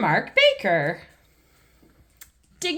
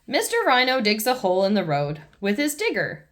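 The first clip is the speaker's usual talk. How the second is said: plain reading voice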